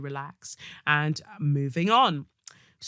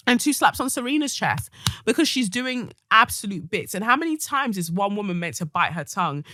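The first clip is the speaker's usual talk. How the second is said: ordinary talk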